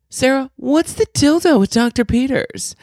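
high-pitched, utterly confident boss call; high mimicked holler; emphatic mock yell. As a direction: imitate the boss screaming it across the office. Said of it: "she's saying it so emphatically".

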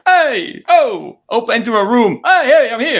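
high, loud mock swagger; tough Italian guy. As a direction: burst in with big punched Italian-guy calls. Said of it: "we can't just go".

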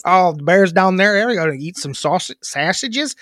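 thick Chicago Bears-fan accent; mock Chicago local. Shown as a demonstration. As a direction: settle into a hammed-up Chicago voice. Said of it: "You found it a little bit there".

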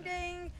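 bell ding. Pretend. Host imitating a bell.

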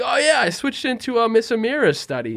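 mock innocent bluff.

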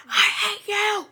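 high, loud mock scream; sudden high burst of imitated rage; acted-out anger. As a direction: shriek it in a hammed-up tantrum. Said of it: "he's going through it again".

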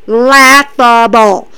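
Laughable stretched very slowly and pressed; drawn-out scorn. Real, not acted. Host scoffing at Dunne's title claim, slow and scornful. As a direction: sneer out Laughable slowly, leaning on it. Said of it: "is laughable".

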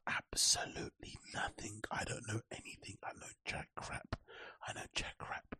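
voiceless whisper, very soft; whispered admission. Real, not acted. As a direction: drop right down to a whisper. Admits knowing nothing, drops to whisper.

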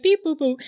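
high, chirpy beep-boop robot noises; a light imitation of a boy's robot beeps; little robot noises. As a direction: play a kid making toy robot sounds. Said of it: "little robot noises".

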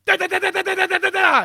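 loud high "da da" bursts with hands up; full-power blast noises. Shown as a demonstration. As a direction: give it everything, big anime blast. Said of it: "you actually go".

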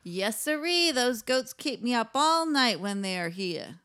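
put-on light Brooklyn accent, a grumbling neighbour; lightly voiced New York neighbour complaining about goats; [name]'s New York accent. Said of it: "keep the accent".

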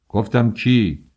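slow, loud angry demand; father raising his voice. A narrator's reading. Father demanding the suitor's name, loud and slow. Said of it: "صدای پدرم بلندتر شد".

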